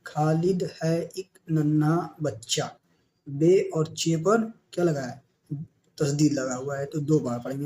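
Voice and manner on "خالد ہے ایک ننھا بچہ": slow reading aloud. Shown as a demonstration. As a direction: read the line slowly and clearly. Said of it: "teacher reads sentence slowly". (آہستہ پڑھ کر سنانا)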